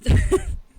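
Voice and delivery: loud, high burst of laughter. Real, not acted. Cohost reacting to a joke about eating a dog, loud laugh.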